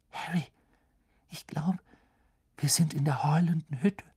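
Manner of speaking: hushed, scared, high whisper; frightened whisper. Acted. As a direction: whisper it in fear. Hermine frightened in haunted house.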